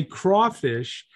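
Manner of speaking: crawfish said slowly with craw; drawn-out crawfish with craw. Shown as a demonstration. Telling the Ukrainian reader how to say it: slow crawfish. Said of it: "next time you read that, say crawfish".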